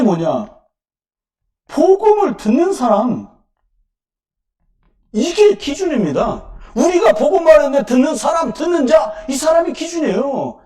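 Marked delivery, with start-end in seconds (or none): 1.7-10.6 emphatic preaching. Acted.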